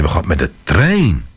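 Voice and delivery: ordinary delivery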